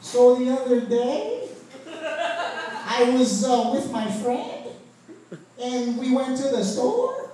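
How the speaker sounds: schoolkid storytelling with questioning rises; mock uptalk. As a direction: lift each phrase end like a question. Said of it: "Why is your voice getting high at the end".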